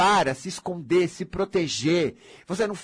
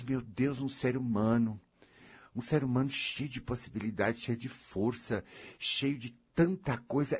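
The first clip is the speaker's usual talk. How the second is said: soft lament; 6 semitones lower, 7 dB quieter than usual. Retold or imitated.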